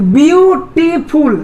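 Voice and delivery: Beautiful slowly, long view then a pause; split into syllables. Shown as a demonstration. Teacher telling students to try saying it, split into syllables. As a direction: stretch beautiful out part by part. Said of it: "तीन जो syllable आ रहा है".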